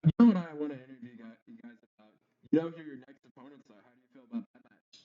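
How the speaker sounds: very faint, smothered speech; a voice lost behind a bad mic; muffled mic. Real, not acted. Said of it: "still cannot hear you".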